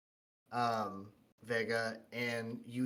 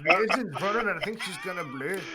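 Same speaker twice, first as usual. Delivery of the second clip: laughing strained voice; 10 semitones higher, 9 dB louder, faster than usual. Acted.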